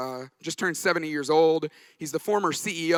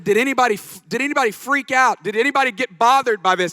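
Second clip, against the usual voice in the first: louder and higher, pressing the question; louder retake; 4 semitones higher, 6 dB louder than usual. Retold or imitated. Mentor at breakfast rephrases his question for the pastor, louder and insistent. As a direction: mentor presses the pastor again about the plan, pushier. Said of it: "Frank, use a word that a seventy-year-old wouldn't use".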